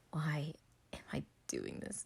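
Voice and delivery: ordinary delivery, nothing marked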